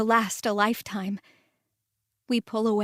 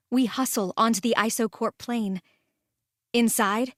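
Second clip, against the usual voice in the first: ordinary delivery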